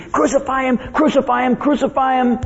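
high, repetitive urging; goading devil. Acted. Devil running around in the crowd, high goading. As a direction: needle them in a high, insistent voice. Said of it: "whispering in people's ears".

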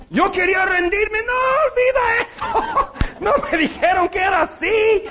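put-on scared boy. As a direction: play the frightened little boy, half laughing.

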